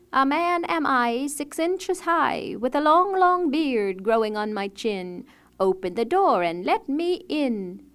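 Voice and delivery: high, slow, sing-song dwarf chant; dwarf calling at the door. A narrator's reading. Dwarf calling through the door at midnight, slow and sing-song. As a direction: chant it slowly like a tiny bearded dwarf. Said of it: "a voice called out".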